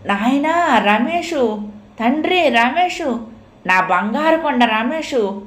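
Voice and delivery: high, slow, drawn-out calling of the name; mother's cry. Acted. Mother calls her lost son again, high and drawn out. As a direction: call out to a long-lost son. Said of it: "మళ్ళీ తల్లి కేక".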